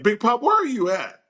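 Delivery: a hint of an eager little kid calling his grandpa; imitating grandson. Grandson voiced on FaceTime.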